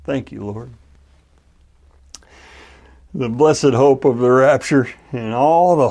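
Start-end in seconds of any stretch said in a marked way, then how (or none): 0.0-0.7 soft, much quieter sigh of thanks; quiet grateful relief; relieved. A narrator's reading. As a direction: breathe it out after the scare. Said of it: "says with relief".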